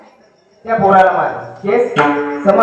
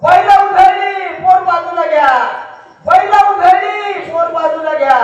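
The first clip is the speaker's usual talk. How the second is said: higher, slowed shout of warning; 6 semitones higher than usual. Retold or imitated. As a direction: cart driver yelling a warning from far off. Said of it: "हा ओरडत होता".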